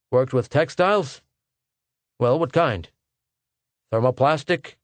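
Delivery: lightly voiced personnel man firing questions; brisk interviewer. A narrator's reading. Personnel man quizzing a job applicant, brisk and businesslike. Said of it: "The questions were fired rapidly".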